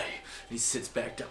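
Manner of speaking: ordinary talk, nothing marked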